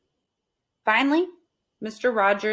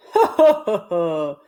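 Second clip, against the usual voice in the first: loud ha ha that fades off; hearty laugh; 5 dB louder than usual. Acted. Mister Rogers laughing at Amelia's question, hearty laugh. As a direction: laugh out loud at her, amused. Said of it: "Mister Rogers laughed".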